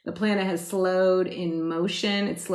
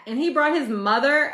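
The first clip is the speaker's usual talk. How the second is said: ordinary delivery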